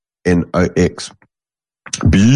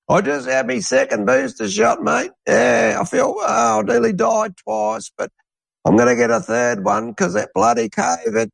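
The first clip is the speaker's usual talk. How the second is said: much higher, put-on old bloke's voice; mocking old Aussie bloke; 14 semitones higher than usual. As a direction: lampoon a gullible old Aussie in his eighties. Mocking booster-loving old bloke.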